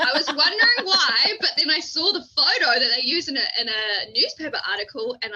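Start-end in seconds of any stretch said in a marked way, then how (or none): 0.0-3.5 laughing through the words. Real, not acted. Laughing while telling her friend the story. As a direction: giggle as you tell it.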